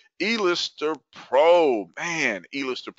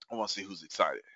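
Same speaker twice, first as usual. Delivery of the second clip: much softer and lower, almost to himself; low, quiet repeat; 6 semitones lower, 11 dB quieter, faster than usual. Real, not acted. Host waits for audience replies, low and quiet. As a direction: say it low, holding the energy back.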